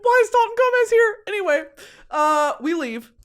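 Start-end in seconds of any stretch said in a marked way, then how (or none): 0.0-1.2 high, loud squeal; mock-stunned squeak of disbelief; shocked reaction. Two friends spotting a celebrity's ex, shocked squeal.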